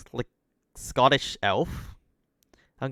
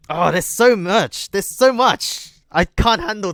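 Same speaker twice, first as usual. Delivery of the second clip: overwhelmed exclaim; 7 semitones higher, 3 dB louder than usual. Real, not acted. Overwhelmed by long game.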